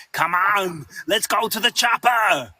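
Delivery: punchy action-hero read of 'Come on, let's go to the chopper'; mock line read, urgent action hero; action movie line read. Shown as a demonstration. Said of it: "I want the line where it's like".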